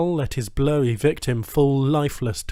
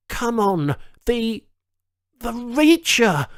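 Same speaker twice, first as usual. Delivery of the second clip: higher, slow strained search ending in triumph; straining to remember; 10 semitones higher, slower than usual. A narrator's reading. Charles forcing out his ship's name, straining. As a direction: drag the name out at last. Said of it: "He babbled nonsensically".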